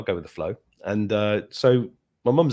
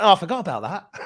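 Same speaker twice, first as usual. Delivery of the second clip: higher, louder mock sheepish mum; imitating mum forgetting; 9 semitones higher, 5 dB louder than usual. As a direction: play mum's airy oops. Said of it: "she went".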